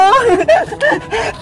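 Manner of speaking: high sneaky giggle; mischievous giggle. Acted. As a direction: giggle while plotting the prank. Prankster giggles at next step. (pilyang hagikgik)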